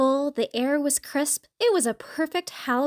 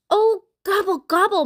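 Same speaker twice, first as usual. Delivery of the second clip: high, moaned turkey gobble; moaning; 6 semitones higher, 5 dB louder than usual. A narrator's reading. Turkey fails with the pirate costume, moaning high. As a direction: moan the gobbles out high and long. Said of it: "moaned Turkey".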